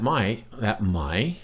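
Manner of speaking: "my" lifting at the end; questioning lift on "my"; rising tone. Shown as a demonstration. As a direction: finish on an upward-gliding "my". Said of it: "rising tone".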